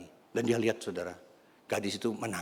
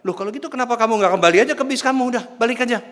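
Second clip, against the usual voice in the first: loud, high, quick retort; rapid, exasperated acted challenge; brusque dismissal; 12 dB louder, faster than usual. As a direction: send them away with raised voice. Acting man brusquely sending girl away.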